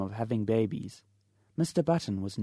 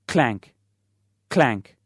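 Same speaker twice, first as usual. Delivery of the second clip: spoken clank; 8 dB louder than usual. Acted. Basin rolls down stairs.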